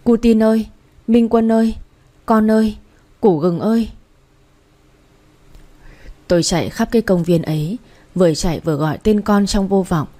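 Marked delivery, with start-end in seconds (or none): 0.0-3.9 frantic mother calling her lost son's names; calling out loudly. A narrator's reading. Mother searching the park, calling out for her son. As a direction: call out for the missing child, voice raised. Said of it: "Tôi gọi lớn".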